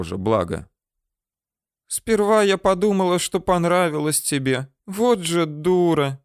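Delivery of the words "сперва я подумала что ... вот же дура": rueful self-mockery. A narrator's reading. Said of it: "Девушка усмехнулась".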